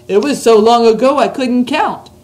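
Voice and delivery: BFG giant voice. Acted. Reads the BFG's lines.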